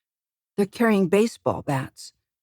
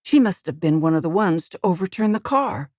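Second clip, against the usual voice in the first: ordinary delivery, nothing marked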